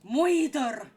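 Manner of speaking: put-on loud "Moider!" in a Scottish teacher's accent; Scottish moider shout. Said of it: "my brain goes".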